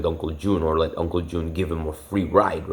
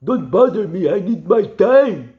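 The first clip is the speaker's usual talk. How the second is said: much higher, louder whining put-on voice; whiny uncle; 14 semitones higher, 7 dB louder, slower than usual. Mocking the uncle's whine about needing his own time. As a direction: whine like a selfish freeloader. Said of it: "Saying shit like that".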